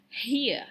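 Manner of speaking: HERE stretched as two gliding vowels, hee-uh; modelled pronunciation. Shown as a demonstration. Teacher shows the way to pronounce a diphthong, HERE as hee-uh. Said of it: "this is the way to pronounce".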